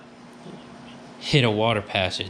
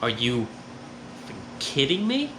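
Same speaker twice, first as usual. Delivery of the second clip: disbelief; 2 semitones higher, 5 dB quieter than usual. Retold or imitated. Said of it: "My face was just like".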